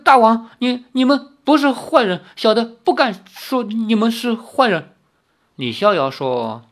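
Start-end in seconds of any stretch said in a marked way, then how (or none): 0.0-4.9 put-on high, halting, stammering old man; nearly crying. A narrator's reading. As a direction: grovel fearfully, on the edge of tears. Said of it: "那老头儿都快哭出来了". (快哭出来似地结巴说)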